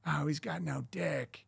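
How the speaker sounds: quiet, slow, catty aside; mock wife gossip. Wife gossiping about Hollywood husband.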